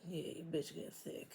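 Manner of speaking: soft whispered brag; whispered aside. Real, not acted. Reader whispers a brag about her own figure. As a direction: whisper it to the camera with a laugh. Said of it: "whispered aside about herself".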